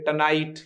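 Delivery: "टनाइट" with a short t-uh start; weak schwa. Shown as a demonstration. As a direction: reduce the first vowel in "टनाइट". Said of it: "टुनाइट को बोलो क्या बोलेंगे".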